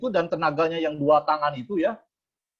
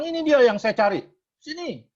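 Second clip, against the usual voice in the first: raised, higher rough summons; higher, louder mock thug call; 8 semitones higher, 4 dB louder than usual. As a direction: call him over like a menacing thug. Thug calls out to speaker.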